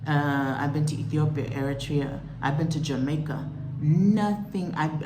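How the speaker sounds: ordinary delivery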